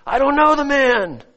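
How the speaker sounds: a mild hammed-up angry oath; cursing denial. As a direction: swear it out, insisting. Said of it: "began to curse and swear".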